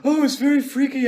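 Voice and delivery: squealing faked terror, rushing; hammed-up frightened Macbeth. Shown as a demonstration. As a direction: parody the bad actor's overdone fright. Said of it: "the worst thing you can do playing Macbeth is go".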